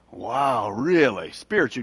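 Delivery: low, hushed mock awe; mock impressed listener. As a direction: play someone quietly impressed. Acts out impressed other people.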